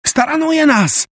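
high, drawn-out cry of alarm; alarmed shout. A narrator's reading. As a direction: yell the warning slowly, in panic. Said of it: "krzyknęła Meilin". (wysoki, przeciągły okrzyk przerażenia)

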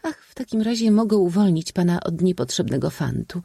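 high voice with a light smile; reply playfully, pitched high; smiling young lady. A narrator's reading. Lady answering her suitor with a smile, high-voiced. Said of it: "odparła z uśmiechem".

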